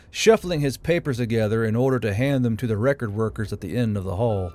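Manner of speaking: ordinary delivery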